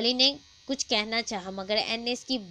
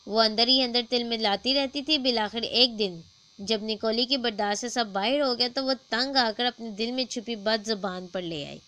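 usual speaking voice

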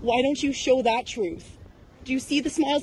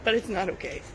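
holding back tears; 6 semitones lower, 3 dB quieter than usual. Real, not acted. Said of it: "I held it in till the cameras are gone".

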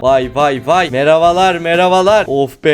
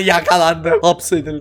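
normal talking voice

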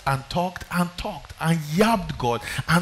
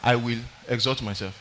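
quiet rebel quote; 5 semitones lower, 7 dB quieter than usual. Retold or imitated. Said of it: "somebody under the leadership of Jehovah said".

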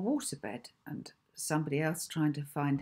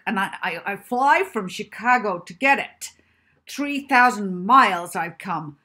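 put-on furious shout, much louder, higher, American accent; bellowing American; 4 semitones higher, 11 dB louder than usual. A narrator's reading. Angry professor bellowing at the librarian, full furious shout. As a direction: roar it at the librarian in a rage. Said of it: "his gruff voice was getting louder and louder".

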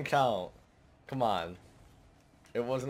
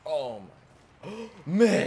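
surprised; 6 semitones higher, 6 dB louder than usual. Real, not acted. Gasp at a teammate's shot.

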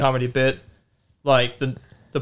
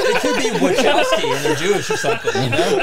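high, laughing hard; 9 semitones higher than usual. Real, not acted. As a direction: crack up while saying it. Cracking up mid-joke.

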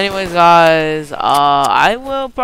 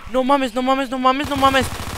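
panicked repeating; 6 semitones higher, 3 dB quieter than usual. Real, not acted. Panicking mid-fight.

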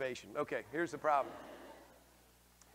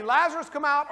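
loud high call by name; Jesus calling his friend out of the grave; commanding call; 12 semitones higher, 13 dB louder than usual. Acted. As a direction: shout the name to the tomb. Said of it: "Does he say".

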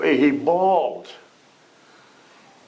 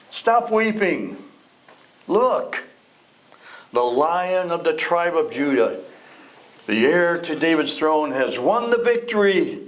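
high, ringing announcement of victory; triumphant proclamation; 6 semitones higher than usual. Acted. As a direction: proclaim it like good news to a weeping man. Elder's triumphant quote in sermon.

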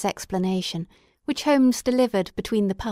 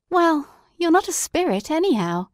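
lightly higher, relieved friend; half joking relief; Watson relieved; 8 semitones higher than usual. A narrator's reading. Watson gripping Holmes's sleeve, light relieved voice.

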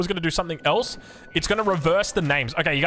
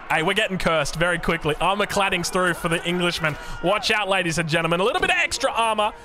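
usual speaking voice

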